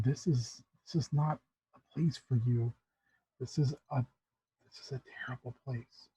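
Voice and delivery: soft, halting warning that fades very low; hushed warning. Acted. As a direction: warn gently, nearly under the breath. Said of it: "she looks at you and she said".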